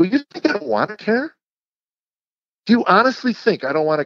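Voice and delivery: worked-up, halting insistence on being understood; exasperated self-defense. Retold or imitated. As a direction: plead your case in a re-enacted quarrel. Re-enacts defending himself to wife.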